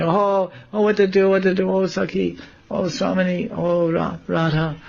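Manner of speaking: high, wailing lament; mock distressed crying. Gopis distressed below the tree, high mock wailing. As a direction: imitate helpless wailing, without real sobs. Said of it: "and they're crying".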